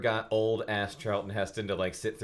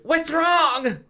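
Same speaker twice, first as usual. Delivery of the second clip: put-on very high, loud falsetto girl voice; Enid blurting; 9 dB louder than usual. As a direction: blurt it out as a panicky teen girl. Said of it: "she blurted".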